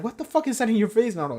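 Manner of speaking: high, quick mock bewilderment; goofing off. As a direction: imitate a goofy, high-pitched question. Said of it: "like, goofing off".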